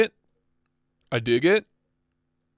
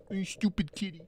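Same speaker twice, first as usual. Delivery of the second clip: off-mic pet talk; 3 semitones higher, 19 dB quieter than usual. Real, not acted. Streamer lets the cat out, off-mic teasing pet talk.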